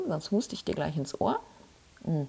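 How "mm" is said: schluckt beim Sprechen; beim Trinken. Real, not acted. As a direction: Mund am Glas, kurzes Mm. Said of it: "ich muss mal einen Schluck trinken". (beim Trinken eines Schlucks)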